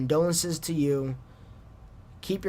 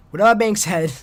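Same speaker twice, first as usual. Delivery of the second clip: final sign-off; 4 semitones higher, 9 dB louder than usual. Real, not acted. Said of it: "for the last time".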